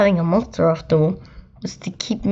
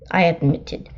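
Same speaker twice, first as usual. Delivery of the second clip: usual speaking voice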